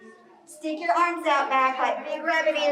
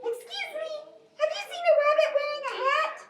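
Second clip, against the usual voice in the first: much higher, quick squeaky squirrel; 14 semitones higher, faster than usual. Shown as a demonstration. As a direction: chirp it fast like a tiny squirrel.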